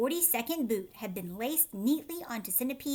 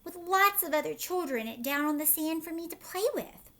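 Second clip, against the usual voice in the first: eager; 6 semitones higher than usual. A narrator's reading. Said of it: "said James eagerly".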